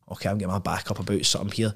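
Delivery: quiet quick inner voice; muttered to himself, fast and hushed; inner thought. Retold or imitated. His own irritation rising, voiced as a quiet thought.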